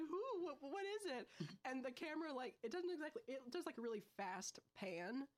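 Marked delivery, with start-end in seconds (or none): 0.0-1.3 mock panic. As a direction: squeak out the questions in alarm. Retelling character's panicked reply.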